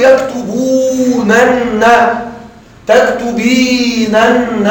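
يكتبونن and تكتبينن hugely drawn out, every nun sounded; very slow يكتبونن، تكتبينن, dwelling on the piled-up nuns; literal emphatic-nun forms. Shown as a demonstration. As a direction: stretch the long vowel and doubled nun in يكتبونن، تكتبينن. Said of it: "لو أكدنا على الظاهر سأقول".

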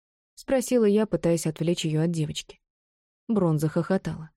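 usual speaking voice

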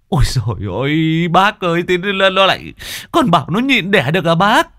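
high, distressed wail of disbelief; exasperated protest. Acted. Husband protests to neighbour about delaying the birth, exasperated.

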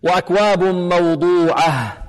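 slow موضوعة closing on an audible h; measured recitation with distinct final h; clear final ha. Shown as a demonstration. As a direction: chant and breathe out the h of موضوعة. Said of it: "الهاء هنا لابد من إيضاحها".

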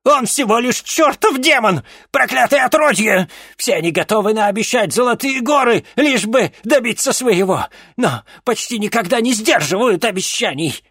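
high, loud furious warning; angry shout. A narrator's reading. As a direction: rail against the demon. Witch warns against demon.

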